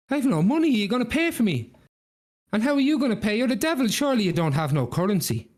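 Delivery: high-pitched, indignant haggling; protesting Jack. Acted. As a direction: argue your way out of paying. Jack argues with the devil.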